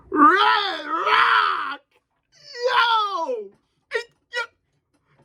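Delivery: high, loud hype scream; excited scream. Real, not acted. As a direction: yell the attack name in joy. Screaming attack name.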